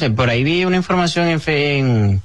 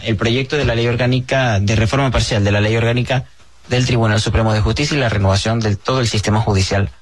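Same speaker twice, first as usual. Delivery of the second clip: usual speaking voice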